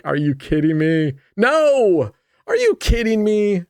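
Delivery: high outraged yell, peaking on no; mock outraged yell. Gambler reliving a lost spin, mock outraged yell. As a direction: yell the no like the bet just died. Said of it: "I was like".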